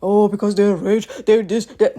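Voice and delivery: dismissive retort. Retold or imitated. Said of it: "why do you think celebrities don't age".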